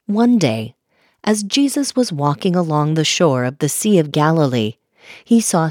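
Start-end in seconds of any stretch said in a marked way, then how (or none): none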